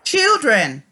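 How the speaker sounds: normal talking voice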